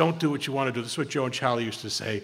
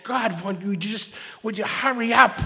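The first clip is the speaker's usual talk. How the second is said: impatient grumble; 8 semitones higher than usual. Retold or imitated. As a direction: hurry them along, fed up in line. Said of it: "This is the head going".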